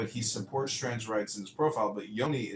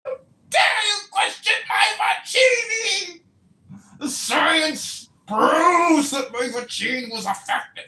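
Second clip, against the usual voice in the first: much higher, slow, loud English accent mockery; mocking English accent shout; 10 dB louder, slower than usual. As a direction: shout it like an outraged posh believer. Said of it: "mocking him in my English accent".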